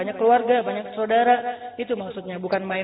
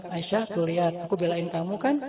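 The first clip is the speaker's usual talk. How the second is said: softer, lower confiding voice; tender aside; 4 semitones lower, 6 dB quieter than usual. Retold or imitated. Husband turns back to his wife, soft tender aside. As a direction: murmur it to her as a quiet reassurance.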